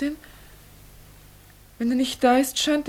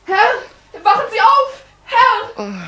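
high, loud call to wake him; urgent high call, louder and louder; 11 semitones higher, 9 dB louder, much slower than usual. Acted. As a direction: shout to rouse a sleeper. Tries to wake student.

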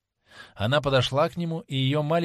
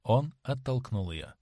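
ordinary delivery

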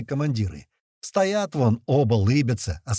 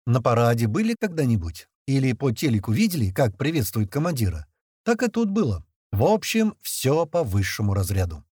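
plain reading voice

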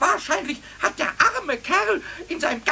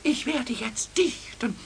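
conspiratorial whisper; 6 semitones lower, 6 dB quieter than usual. Acted. Announcing a poem secretly.

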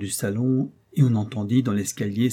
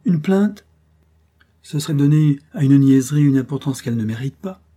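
1 incredulous; 4 semitones higher, 8 dB louder than usual. A narrator's reading. Countess dismissing the complaint.

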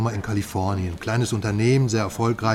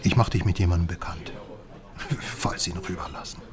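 secretive whisper; 5 semitones lower, 6 dB quieter, faster than usual. Acted. Whispering the meeting plan to his contact. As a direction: keep it low so nobody overhears.

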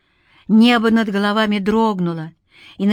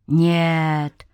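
slightly lowered, dry spirit voice; rustling; 3 semitones lower than usual. A narrator's reading. Spirit denying it will cheat, rustling. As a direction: let the word rustle out evenly. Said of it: "прошелестел Навий".

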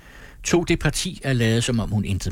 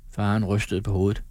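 ordinary delivery, nothing marked

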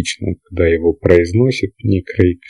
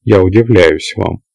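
plain reading voice

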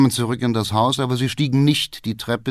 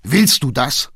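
sharp, incredulous challenge; father throwing the question back in anger; indignant outburst; angry call; 4 semitones higher, 4 dB louder than usual. A narrator's reading. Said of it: "ruft der Vater zornig".

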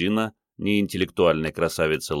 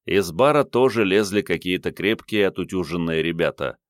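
usual speaking voice

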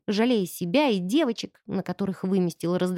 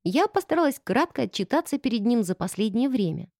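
plain reading voice

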